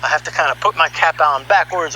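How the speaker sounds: ordinary delivery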